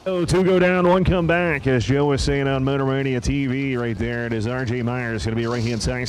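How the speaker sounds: punchy drag-race announcer, loud bursts on key calls; live race call. Acted. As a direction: call the matchup with rising force on names.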